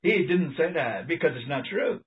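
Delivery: ordinary talk, nothing marked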